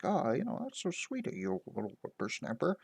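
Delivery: put-on high, soft little old lady voice; lilting high granny voice, dropping at the end; sweet old lady. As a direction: coo it like everyone's grandmother. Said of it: "like, a nice little old lady in the town".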